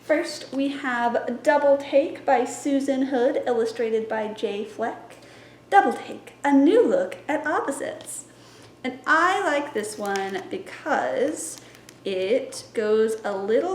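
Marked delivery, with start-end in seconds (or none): none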